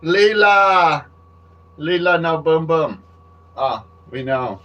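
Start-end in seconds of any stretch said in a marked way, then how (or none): 0.0-3.0 loud, high hammed-up Australian yelling; mock Australian shout. Joking about his Australian shirt, loud Australian yell. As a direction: shout it like a cartoon Aussie bloke. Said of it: "I'm wearing my Australian t-shirt".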